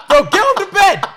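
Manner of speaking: high, loud yelling through laughter; panicked shout. Retold or imitated. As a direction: shout at your mates to move. Acted panic as everyone flees the bed.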